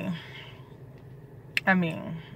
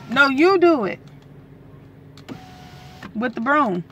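raised, higher voice giving an order; louder, higher order to husband; loud insistence; 6 semitones higher, 5 dB louder than usual. Real, not acted. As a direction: insist he do it himself, loudly. Ordering husband to use broom.

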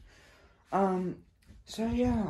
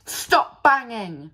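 loud, drawn-out shout; irritated yell; 3 semitones higher, 8 dB louder, slower than usual. Real, not acted. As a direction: yell at someone to stop the noise. Sudden yell at someone banging.

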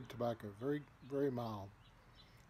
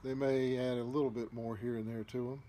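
usual speaking voice

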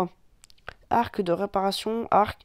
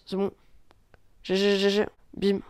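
ordinary talk, nothing marked

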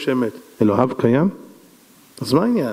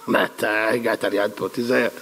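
low, softer acted threat; low warning; 6 semitones lower, 4 dB quieter than usual. King warning the advisor who annoys him, menacing. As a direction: drop low and quiet to warn.

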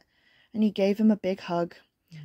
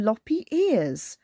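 slow; much slower than usual. Acted. Dwelling on funny words.

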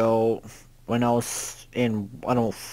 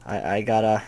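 ordinary talk

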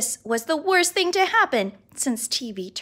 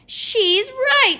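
high, loud amazed shout; excited shout; 11 semitones higher, 6 dB louder than usual. A narrator's reading. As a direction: burst out in delight. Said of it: "shouted Ryan".

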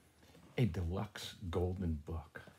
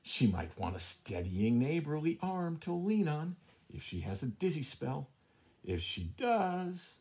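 sly cat musing; 7 semitones higher than usual. Acted. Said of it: "he thought".

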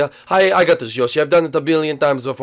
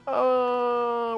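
long, high, soft "uh"; drawn-out uh while hunting for something; searching hum; 7 semitones higher, 7 dB quieter than usual. Pretend. Searching for the phone.

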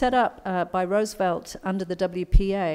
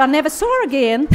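higher, louder, laughing American punchline; laughing American accent; 7 semitones higher, 8 dB louder than usual. Retold or imitated. [name] finishing the lion story, laughing in an American accent. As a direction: deliver the last line laughing in an American voice. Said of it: "excuse my American accent".

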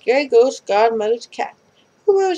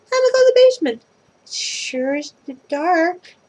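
high excited voice; 7 semitones higher than usual. Acted. Character voice in story.

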